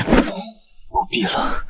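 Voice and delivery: slow, breathless whisper; weak refusal. Acted. The weakened chancellor refuses help in a whisper. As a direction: barely breathe the words.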